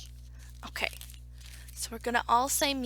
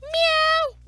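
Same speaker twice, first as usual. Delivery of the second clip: high, loud, drawn-out meow; cat's meow; 11 dB louder than usual. Acted. Storyteller leading everybody in the story's meow, high and loud. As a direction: belt out a long high meow. Story chorus of animal sounds.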